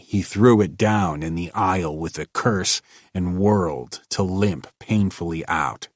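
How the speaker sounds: ordinary delivery, nothing marked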